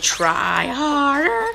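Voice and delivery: slow, high sing-song taunt; mocking game voice. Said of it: "They kept saying".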